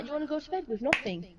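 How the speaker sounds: fast, sharp warning; threatening quickly. Retold or imitated. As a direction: rattle off the threat. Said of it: "I'm like".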